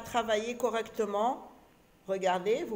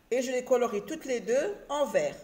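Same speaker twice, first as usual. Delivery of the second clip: normal talking voice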